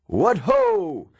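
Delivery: shouted battle cry. Retold or imitated. Teacher in Roman armor challenges the class, ringing shout. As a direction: bellow it like an armored knight at a tournament. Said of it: "dressed up in a suit of Roman armor and shouted".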